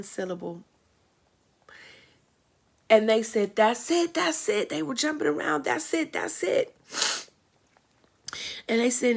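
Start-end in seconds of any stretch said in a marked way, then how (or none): 3.5-6.7 high, eager cheering, repeated; excited cheering. Retold or imitated. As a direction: cheer her on as if jumping around. Women cheering her first syllable.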